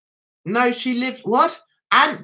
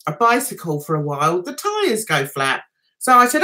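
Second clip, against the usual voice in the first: ordinary delivery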